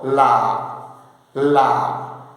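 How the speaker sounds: "La" dropped very low, on a low tone. Shown as a demonstration. Teacher shows students the low tone on "La". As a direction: sink "La" to the bottom of the voice. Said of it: "Acento bajo".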